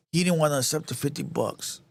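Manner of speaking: ordinary talk, nothing marked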